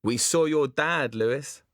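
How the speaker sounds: taunting. Acted. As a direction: needle him with fake sweetness. Lloyd taunting Lewis.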